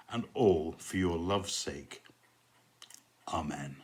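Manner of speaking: slow, measured prayer with long pauses; unhurried liturgical blessing; evening prayer. Real, not acted. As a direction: pray line by line, solemnly. Says the usual evening prayer.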